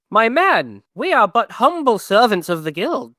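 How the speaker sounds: pompous outrage at a stubborn guard; indignant noble. Acted. Dwarf noble berates the guard.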